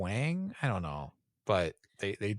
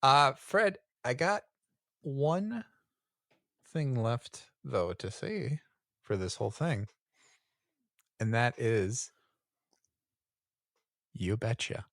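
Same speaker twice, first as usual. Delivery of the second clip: put-on Midwest accent; 4 semitones higher than usual. Mock Midwest sign-off.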